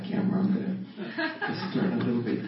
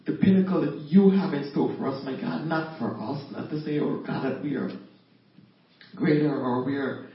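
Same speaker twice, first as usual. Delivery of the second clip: louder, raised praying voice, building in urgency; fervent prayer; 2 semitones higher, 6 dB louder than usual. Acted. Pleading God's promise of protection for everyone, fervent prayer. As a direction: pray with conviction, leaning on key phrases.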